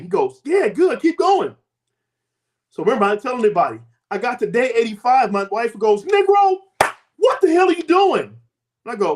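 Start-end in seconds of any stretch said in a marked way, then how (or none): 0.4-1.6 high-pitched retold encouragement; high, eager go-ahead; encouraging caller. Caller cheering him on, retold. 6.0-8.4 high, loud mock outrage; hammed-up high-pitched scolding; exasperated wife. Wife confronts him, retold.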